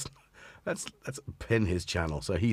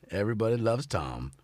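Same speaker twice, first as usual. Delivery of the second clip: normal talking voice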